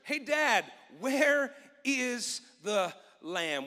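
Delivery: drawn-out question with weight on where; slow it right down on the key line; slow emphatic question. Acted. Preacher repeats key question slowly.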